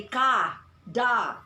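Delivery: KA, DA as two loud full ah syllables; over-pronounced vowels. Shown as a demonstration. Teacher showing what not to say, over-pronounced vowels. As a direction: punch KA and DA separately with open vowels, no schwa. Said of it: "So you do not say".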